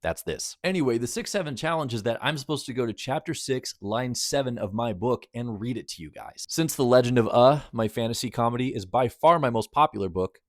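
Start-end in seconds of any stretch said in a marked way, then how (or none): none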